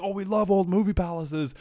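high, enthusiastic mock Newfie accent. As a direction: gush like a Newfoundland tourist. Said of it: "that was their Newfie accent".

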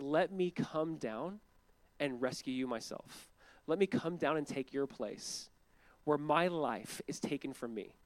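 slow, gentle, earnest pledge; measured, heartfelt promise; tender offer. Acted. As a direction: offer it slowly and quietly, with care. Voices God offering to save.

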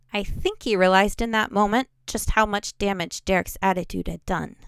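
ordinary delivery, nothing marked

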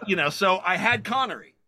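usual speaking voice

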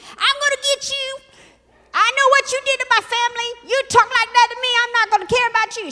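high, loud yell across the street; mock screaming. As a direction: yell threats at someone as a screaming woman. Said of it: "screaming and yelling".

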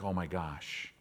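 low, soft dismay; waking up sick again, low and soft dismay; dismayed groan. Retold or imitated. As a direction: let it sink in quietly.

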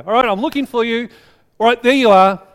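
high, loud, pleased with himself; triumphant finder. Retold or imitated. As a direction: gloat over the easy catch. Competitive young [name] finding kid.

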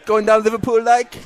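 Scouse accent attempt. Host copying pub owner, Scouse accent. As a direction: imitate a Scouser. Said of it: "very scratchy from the back of the throat".

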